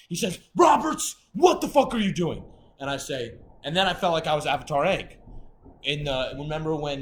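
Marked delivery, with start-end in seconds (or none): 0.5-2.4 loud, higher imitated officer's shout; officer yelling. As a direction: yell it like an angry guard calling out an inmate.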